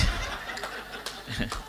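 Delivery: breathy, wordless burst of laughter; amused laugh. Real, not acted. Preacher laughing at selfies in church, amused laugh. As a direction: crack up at the absurdity. Laughs at photos taken during worship.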